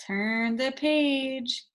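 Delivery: put-on slow, clear phrase for children to copy; slow model phrase. Shown as a demonstration. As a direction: say each word slowly for the class. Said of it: "Everyone say and touch".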